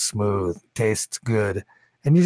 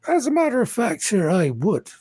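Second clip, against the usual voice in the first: raised, polite tavern keeper; a higher, obsequious barkeep serving guests; bartender voice; 8 semitones higher than usual. Acted. Bartender figure continues.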